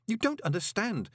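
high, urgent plea; desperate to be believed; scared insistence; panicked. A narrator's reading. Frightened man pleading with golem.

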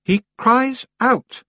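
CRIES OUT slow and high; slow, pointed "cries out"; insistent repeat. A narrator's reading. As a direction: draw out "cries out", pitched high. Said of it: "Roland repeated".

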